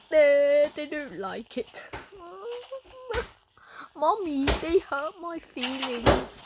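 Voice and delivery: hammed-up whiny fake sob to Mommy; mock crying. Gamer pretends to tattle to Mommy about his channel, mock crying. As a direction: put on a babyish, hurt wail, drawn out.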